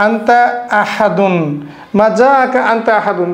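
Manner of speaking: ordinary talk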